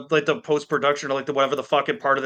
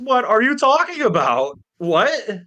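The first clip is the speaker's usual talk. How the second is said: high-pitched baffled question; incredulous reaction; 6 semitones higher, 3 dB louder, slower than usual. Retold or imitated. Podcaster answering an absurd claim, incredulous. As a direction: ask it high, in utter disbelief.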